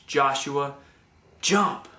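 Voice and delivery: whispered name, then jump snapped out high and loud; loud inner command. Retold or imitated. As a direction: build from a hush to a forceful jump. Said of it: "definitely just as loud in my head".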